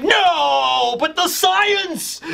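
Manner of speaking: high, slow cry of dismay; dismayed Queen. Retold or imitated. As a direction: wail over the lost science. Queen sees the suit destroyed.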